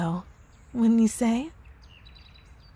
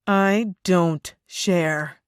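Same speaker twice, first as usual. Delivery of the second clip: menacing; 3 semitones lower, 4 dB louder, much slower than usual. Acted. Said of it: "I don't mean to lose my composure".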